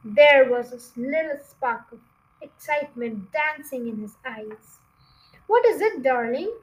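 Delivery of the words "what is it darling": quick eager question. Acted. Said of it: "said Missus Fox quickly".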